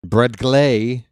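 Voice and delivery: "Brad Glay" with GLAY punched and rising; stress on GLAY. Shown as a demonstration. Announcer still puzzling over the name, stress on "Glay". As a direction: question it, hitting "Glay" hard. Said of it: "put the emphasis on the second syllable".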